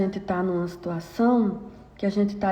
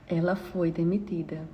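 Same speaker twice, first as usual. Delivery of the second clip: much softer, falling close; soft repeat; 5 semitones lower, 8 dB quieter than usual. Shown as a demonstration. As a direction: drop to a soft, heavy repeat.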